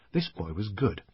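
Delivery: usual speaking voice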